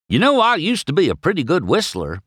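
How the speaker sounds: high, quick, bragging tow truck; chatty Mater. A narrator's reading. Mater boasting to McQueen at work, chatty and high.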